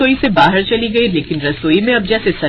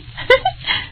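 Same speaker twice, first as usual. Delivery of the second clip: short airy high titter; a quick sneaky laugh; breathy giggle; 5 dB quieter than usual. Acted. Bahus giggle secretly in the kitchen, airy titter.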